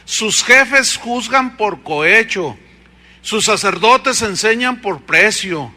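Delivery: forceful scripture reading, loud and ringing; preaching. Acted. Preacher thundering scripture at the congregation, loud. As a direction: proclaim the verses to the congregation.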